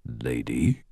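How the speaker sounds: low rumbling monster voice. A narrator's reading. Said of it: "it rumbled".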